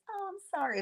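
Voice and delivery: high, mock-sorry voice; mocking fake apology. Mocking Nell's apology to viewers with a high mock-sorry voice. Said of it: "Nell played this".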